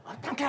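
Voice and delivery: 없단께 lifted high with the weight on it; 없단께 said high and insistent in Jeolla style; stress on 없단께. Shown as a demonstration. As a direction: lean hard into 없단께. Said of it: "스트레스를 거기다 줘야 합니다".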